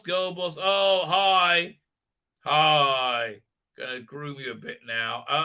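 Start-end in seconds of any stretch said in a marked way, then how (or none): none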